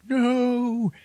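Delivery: a high, stretched-out wail of no; disbelieving no. Retold or imitated. Passenger told he can't reach the bridge, high stretched no. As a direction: let it climb way up in disbelief. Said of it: "And he's like".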